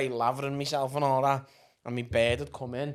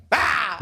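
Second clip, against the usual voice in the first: loud sudden boo-style roar; mock scare yell at a toddler; jump-scare shout; 7 semitones higher, 6 dB louder than usual. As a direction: leap out from behind the door. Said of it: "and I just go".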